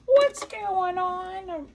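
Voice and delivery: high, loud, drawn-out cry of dismay; exasperated surprise. Real, not acted. Quilter to her sewing machine, loudly dismayed. Repeats it louder as bobbin fails.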